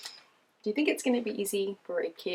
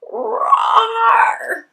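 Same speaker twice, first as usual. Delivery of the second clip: huge high shouted roar; loud roar; 14 dB louder than usual. Acted. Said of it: "roared as loud as I could".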